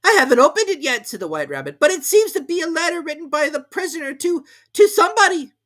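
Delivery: high, loud hurried rabbit. A narrator's reading. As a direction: rush it as the rabbit.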